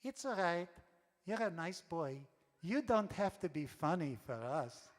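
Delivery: put-on little old lady's heavy European accent. Old lady at front table to young comic, heavy European accent. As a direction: put on a thick European accent, kindly dismissive. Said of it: "saying in a heavy European accent".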